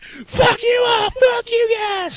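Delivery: high slow mock scream; screaming drunk woman. Arrested woman screaming at cops, high mock scream. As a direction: shriek it from the cruiser. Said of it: "She's like".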